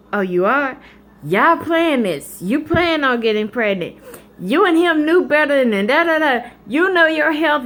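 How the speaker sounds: high, loud scolding mother; imitating mom scolding; lecturing mom. Mom lecturing about pregnancy.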